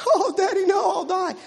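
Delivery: put-on high, pleading little kid; scared child voice. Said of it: "child voiced in story".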